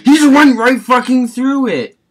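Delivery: high, loud outraged yell; raging gamer. Real, not acted. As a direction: yell in disbelief at the glitch. Outraged at game glitch.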